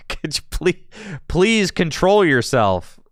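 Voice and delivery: high, loud, exasperated begging; mock pleading. Host telling the card to behave, mock pleading. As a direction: beg it in playful exasperation, stretching the words.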